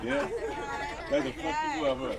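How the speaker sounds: high mock thug voice; hammed-up street tough; tough guy quote. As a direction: play the tough guy for laughs. Voices the crowd's tough reply.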